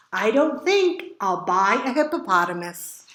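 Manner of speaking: ordinary delivery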